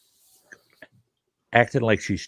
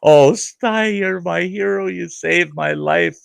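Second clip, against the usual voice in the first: much higher, gushing mock female voice; rescued woman gushing over Sty; swooning woman; 7 semitones higher, slower than usual. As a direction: coo it like a grateful damsel. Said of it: "They're always like".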